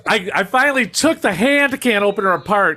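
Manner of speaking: usual speaking voice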